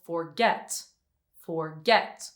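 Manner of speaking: "forget" with the second syllable stressed, rising; stress on last syllable. Shown as a demonstration. Teacher giving another example of final stress, "forget" stressed on its second syllable. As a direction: lean on the end of "forget", lifting it. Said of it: "only if the last syllable is stressed".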